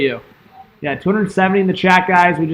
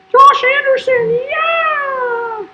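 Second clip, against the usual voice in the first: very high, drawn-out falsetto cheer; hammed-up squealing celebration; mock fan cheer; much slower than usual. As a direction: squeal the scorer's name, stretched out. Cheering the goal scorer.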